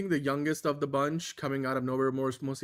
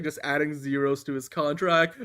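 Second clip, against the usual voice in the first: ordinary talk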